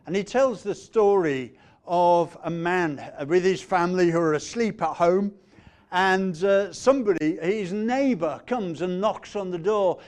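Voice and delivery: preaching at full force. Acted. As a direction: tell the parable with full preaching energy. Parable told at full force.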